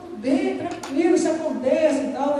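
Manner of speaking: quick, high, caught-off-guard reply; the clerk hurries to smooth things over, surprised; startled reassurance. Retold or imitated. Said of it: "ele ficou espantado".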